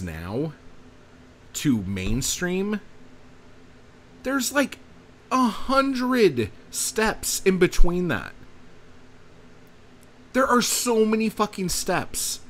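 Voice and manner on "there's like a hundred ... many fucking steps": exasperated rant. Real, not acted. Ranting about poetry going mainstream.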